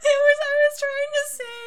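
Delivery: high, laughing explanation. Real, not acted. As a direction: explain while cracking up. Explaining misspeak while laughing.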